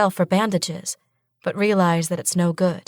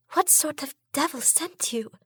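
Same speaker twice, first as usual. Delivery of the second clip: high, soft, frightened; a scared sister shrinks back; fearful whisper; 9 semitones higher, 7 dB quieter than usual. A narrator's reading.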